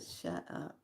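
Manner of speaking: hushed whisper, awed; a stunned, whispered shut up; whispered disbelief. Retold or imitated. Stunned reaction to the match.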